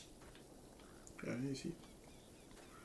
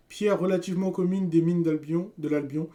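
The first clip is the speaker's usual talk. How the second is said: item description read; 5 semitones higher, 10 dB louder than usual. Acted. Reading item description aloud loudly.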